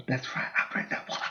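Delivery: breathy unvoiced whisper, fast; whispered aside. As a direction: whisper it proudly under your breath. Whispered aside about water boy.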